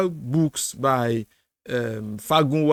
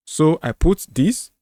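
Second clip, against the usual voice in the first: normal talking voice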